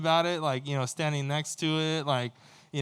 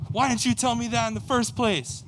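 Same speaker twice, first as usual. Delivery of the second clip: raised, higher-pitched indignant demand; mad border guard; 8 semitones higher, 4 dB louder than usual. Retold or imitated. Guard berating a traveler over his dad, retold angrily. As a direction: imitate an angry guard scolding. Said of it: "that's when he got mad".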